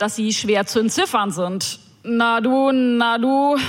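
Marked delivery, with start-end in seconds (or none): 2.0-3.6 mumbled and slurred, running the words of "Na du, na du" together; slurred drunk greeting. Retold or imitated. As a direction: slur "Na du, na du" like a drunk on the night bus. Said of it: "lallte mir ein Daunenjackenträger". (gelallt, "Na du, na du" verschliffen wie ein Betrunkener)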